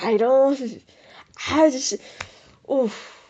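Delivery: loud, high, flustered sputter; exasperated quote. Retold or imitated. Retelling a frustrating moment, loud flustered sputtering. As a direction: sputter it out like you're lost for words. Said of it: "and you're just like".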